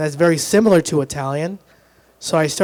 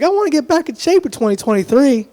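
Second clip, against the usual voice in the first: chirpy gym-goer; 8 semitones higher than usual. Acted. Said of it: "people are like".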